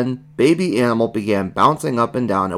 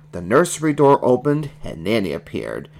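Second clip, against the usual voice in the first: ordinary delivery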